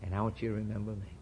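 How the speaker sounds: ordinary delivery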